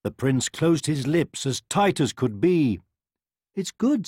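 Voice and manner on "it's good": coaxing mother. A narrator's reading. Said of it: "said his mother".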